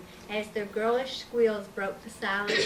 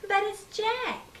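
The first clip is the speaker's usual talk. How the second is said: put-on little girl voice; 11 semitones higher, slower than usual. Child explains booger.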